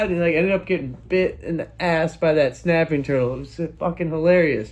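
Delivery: normal talking voice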